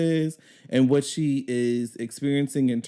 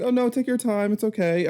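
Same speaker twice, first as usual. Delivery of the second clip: high, sugary customer-service voice; sweet as pie, hiding irritation; mock-sweet call center agent voice; sugary fake politeness; 8 semitones higher than usual. Overly sweet reply to customer.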